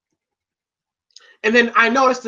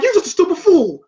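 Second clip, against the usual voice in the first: higher-pitched retold insult; mock taunt; 8 semitones higher, 3 dB louder than usual. Clubber insulting Rocky, mock taunting. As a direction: jeer it like a movie boxer.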